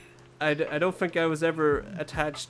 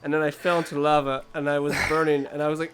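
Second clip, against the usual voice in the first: usual speaking voice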